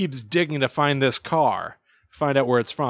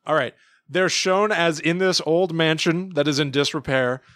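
ordinary talk, nothing marked

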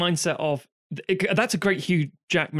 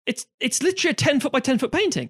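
exasperated guide; 9 semitones higher than usual. Retold or imitated. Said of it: "It's like".

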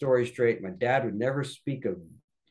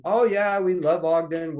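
high, louder voice of keen agreement; eager endorsement; 5 semitones higher, 5 dB louder than usual. Retold or imitated. Church leaders backing a candidate, eager endorsement. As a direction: chime in with hearty approval.

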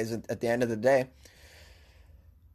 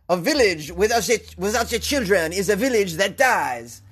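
put-on French accent, higher than usual; 3 semitones higher than usual. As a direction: quote the mayor in an amateur French accent. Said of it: "Was that a good French accent?".

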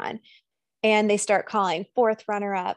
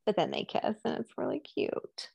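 ordinary delivery

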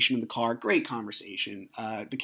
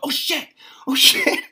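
high-pitched alarmed yelp, rising louder on the repeat; panicked exclamation; 9 dB louder than usual. Retold or imitated. Dad spotting his ex at the gas station, panicked. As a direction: replay a sudden panic at full pitch.

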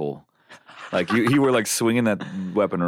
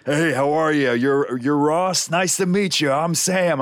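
higher-pitched hearty introduction; Sam Jones; 6 semitones higher, slower than usual. Retold or imitated. Film star introducing himself to a fan, hearty imitation. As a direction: greet a fan with drawn-out friendliness. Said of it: "when I met him".

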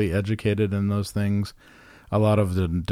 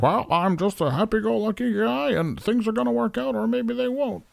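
Jimmy Stewart impression; 13 semitones higher than usual. Doing Jimmy Stewart for a friend, high and folksy. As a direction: lift the voice way up like a jolly old movie star. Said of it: "kinda like Jimmy Stewart".

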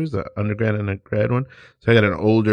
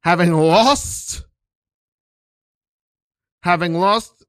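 grand pompous teacher; 4 semitones higher than usual. Pretend. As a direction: intone it like a guru. Teacher intones scene grandly.

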